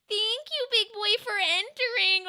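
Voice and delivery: high, whiny crying-style voice; cringe voice. Pretend. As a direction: coo it in the silly cringe voice. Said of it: "in that voice".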